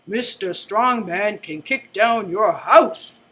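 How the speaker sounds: slow, booming announcer voice; deep TV announcer voice. Acted. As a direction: announce it like a TV promo. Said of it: "I make my voice deep to sound like a T V announcer".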